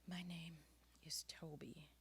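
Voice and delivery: fading to a breathless whisper; gasped out. Acted. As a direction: gasp the words out, spent. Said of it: "gasped the young man".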